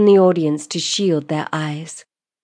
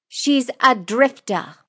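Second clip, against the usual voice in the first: slow, higher, deliberate statement; with conviction; 6 semitones higher, slower than usual. Acted. Lordess staring down the accused, stating with conviction. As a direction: state it slowly, as a firm accusation. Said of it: "states with conviction in her voice".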